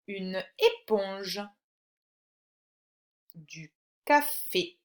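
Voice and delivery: slow, spaced-out word list; slow dictation. Shown as a demonstration. Teacher dictates words to a pupil to find a sound, slow dictation. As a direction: dictate each word slowly, syllable by syllable.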